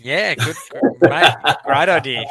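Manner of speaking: real laughter over the reply; amused host cracking up at the joke; laughing. Real, not acted.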